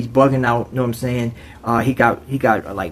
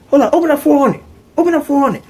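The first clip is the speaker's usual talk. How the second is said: mock officer call; 13 semitones higher, faster than usual. Officers ordering the door opened, high mock call.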